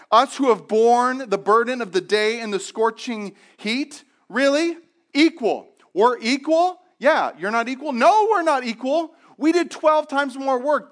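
high, loud indignant complaint; grumbling workers. Acted. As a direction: protest it's not equal, outraged. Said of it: "they grumbled at the master".

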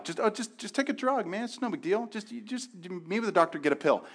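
a casual friend shrugging it off; dismissive friend. Retold or imitated. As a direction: brush it off like it's nothing. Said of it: "here's just an example of how we oversimplify".

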